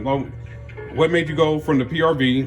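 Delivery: normal talking voice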